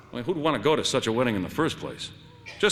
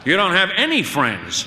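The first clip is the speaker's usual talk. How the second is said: sharp, loud and high jab; angry retort; 8 semitones higher, 8 dB louder than usual. Acted. Wife hitting back at her husband, angry and loud. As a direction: cut him down hard.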